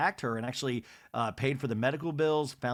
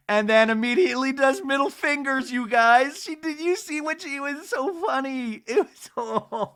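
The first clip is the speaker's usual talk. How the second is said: high, loud, delighted outburst; can't contain the glee; real delight, pitched way up; excited gushing; 11 semitones higher, 7 dB louder than usual. Real, not acted. Gushing over the middle fingers moment.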